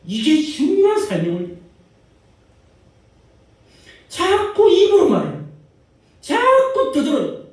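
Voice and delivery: mock chatterer. Mocking people who keep talking.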